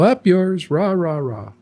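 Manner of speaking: hammed-up mob jeer, milder; jeering protesters. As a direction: imitate a defiant crowd talking back. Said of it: "people are gonna go".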